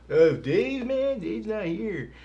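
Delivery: high, slow spaced-out stoner. Retold or imitated. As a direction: drawl it out, half asleep. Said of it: "the different skits".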